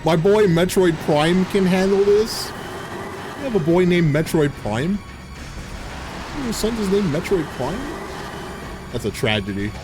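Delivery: ordinary delivery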